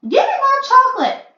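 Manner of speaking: demanding shout. A narrator's reading. Boy on stage shouting at the witch for chocolate. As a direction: yell it, stamping your foot.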